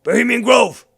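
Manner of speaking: high, very loud hollered outburst; mock shout. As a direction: shout it like a frantic slogan. Mock outburst cutting into the clip.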